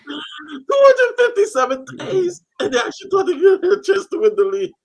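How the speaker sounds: high, still giggling through the words; trying to talk while laughing. Real, not acted.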